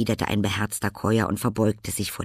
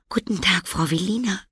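put-on much higher, sweet girlish greeting; girl's voice; 11 semitones higher, 3 dB louder than usual. A narrator's reading. Girl greeting old Velina, polite.

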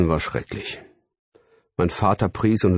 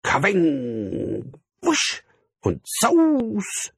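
high, drawn-out cartoon whooshes; imitated whizzing; 13 semitones higher, much slower than usual. A narrator's reading. Narrator mimics things flying through the window, imitated whizzing. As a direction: swoop each sound-word like a flying missile. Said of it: "Ich hörte das Schnacken und Sausen und Pfeifen".

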